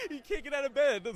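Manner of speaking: high, loud, laughing exaggeration; amused exaggeration. Real, not acted. Author describing his bummed kid character, amused and high-pitched. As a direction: squeal it through amusement.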